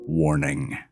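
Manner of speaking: plain reading voice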